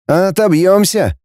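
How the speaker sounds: unsure. A narrator's reading. Boy reckons they can fight off attackers, unsure. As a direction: say it without quite believing it. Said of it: "неуверенно сказал Кутнов".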